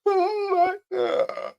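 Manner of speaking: high, slow, half-laughing fake sob; hammed-up crying, drawn out high; mock crying. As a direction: ham up a tearful wail. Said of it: "Please don't come on P T time crying like that".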